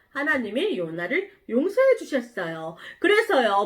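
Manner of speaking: usual speaking voice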